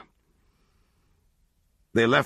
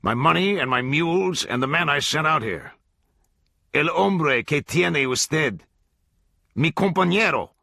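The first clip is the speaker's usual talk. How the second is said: higher, louder repeated demand; cold demand; 7 semitones higher, 3 dB louder than usual. A narrator's reading. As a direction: press him harder. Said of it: "he said".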